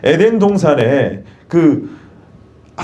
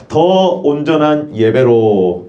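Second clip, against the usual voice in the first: slow, drawn-out, lower call for repetition; lead the repeat; 4 semitones lower, much slower than usual. Real, not acted. Preacher has congregation repeat the sermon title, slow and measured. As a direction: stretch it out for the room to echo.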